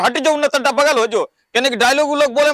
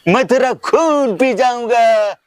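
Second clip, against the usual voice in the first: loud opening, then drawn-out menace; dangerous film dialogue; 3 dB louder, slower than usual. Acted. Fan showing off a dangerous dialogue, menacing filmi delivery. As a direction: play the threatening film hero, stretching the words. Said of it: "देखे कहनो खतरनाक dialogue".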